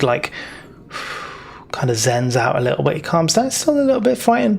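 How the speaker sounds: ordinary talk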